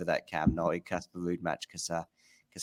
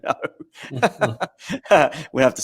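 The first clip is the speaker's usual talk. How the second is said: laughing; 7 semitones higher, 7 dB louder, much faster than usual. Real, not acted. Jokes about Jean's semi-final problem.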